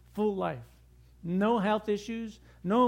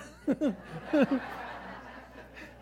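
breathy laugh; 6 semitones higher than usual. Real, not acted. Preacher chuckles at his own fishing story, breathy laugh.

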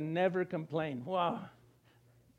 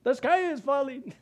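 higher, louder rising alarm; mock alarmist crying the sky is falling; doom panic; 4 semitones higher, 4 dB louder than usual. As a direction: build into panicky doom-saying. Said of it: "look at life negatively".

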